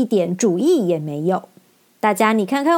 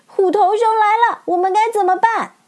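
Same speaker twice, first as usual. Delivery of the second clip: much higher, louder alarmed cry; frightened cry; 11 semitones higher, 3 dB louder than usual. Acted. Dorothy cries out in fear, much higher and louder. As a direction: panic as the beast nears. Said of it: "陶乐斯吓得脸色发白".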